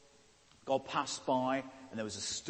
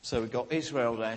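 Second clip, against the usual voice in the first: normal talking voice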